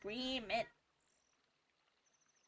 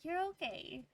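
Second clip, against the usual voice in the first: higher, tender reassurance to the cat; reassuring; 7 semitones higher, 4 dB louder than usual. Real, not acted. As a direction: soothe the upset cat gently.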